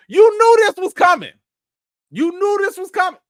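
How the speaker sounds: loud, high-pitched accusation; hammed-up squawk of blame; mock outrage. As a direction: confront him at the top of your range. Mock confrontation with a viewer.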